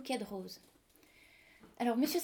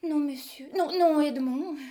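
loud, slow, higher cry of no; loud pleading protest; 3 semitones higher, 8 dB louder, slower than usual. Acted. Wife pleading with Edmond before the court, loud slow protest. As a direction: beg him to stop, loudly.

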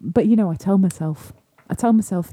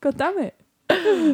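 drawn-out mock exasperation; 7 semitones higher, much slower than usual.

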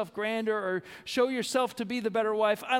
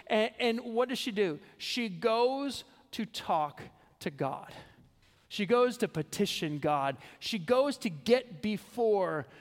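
ordinary talk

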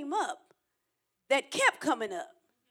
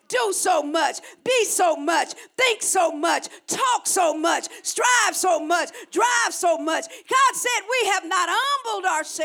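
loud, driving sermon delivery, higher-pitched; impassioned preaching; 6 semitones higher, 6 dB louder than usual. Real, not acted. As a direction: preach it hard, hammering each repeated phrase. Preaches at full force.

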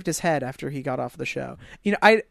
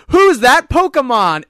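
high, booming, stretched-out question; loud imitated show bumper; mock announcer; 8 semitones higher, 11 dB louder, much slower than usual. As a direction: belt it like a cartoon quiz announcer. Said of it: "they cut and he goes".

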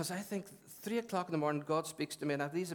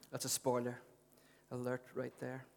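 ordinary delivery

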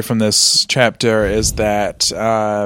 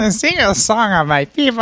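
Gungan voice; 7 semitones higher than usual. Pretend.

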